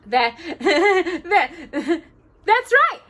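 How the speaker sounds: high, loud giggly dwarf through a held nose; high muffled laughing dwarf. A narrator's reading. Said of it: "in a muffled voice".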